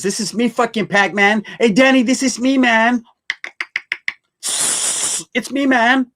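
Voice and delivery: playful falsetto read of a chat message; high put-on silly voice. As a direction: squeak it out like a goofy character.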